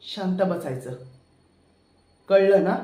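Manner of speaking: loud threat ending in a yell; angry threat. Acted. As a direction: shout the order down on her. Villain threatening woman.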